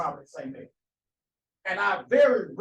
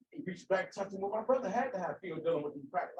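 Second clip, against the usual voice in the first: normal talking voice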